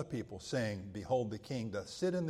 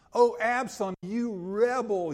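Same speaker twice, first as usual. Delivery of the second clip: accusing cry; 9 semitones higher, 9 dB louder than usual. Retold or imitated. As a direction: cast the rebel off. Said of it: "He could have said".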